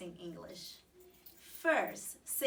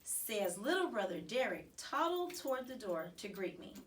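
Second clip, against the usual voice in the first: ordinary delivery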